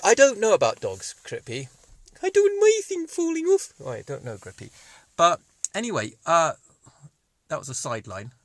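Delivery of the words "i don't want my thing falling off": very high, loud squeaky dog voice; a silly little dog answering back; high shrieky cartoon pup; daft dog puppet.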